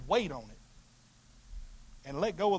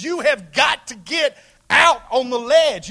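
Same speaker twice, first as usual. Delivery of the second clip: shout; 6 semitones higher, 12 dB louder, slower than usual. Real, not acted. Preacher shouting to congregation.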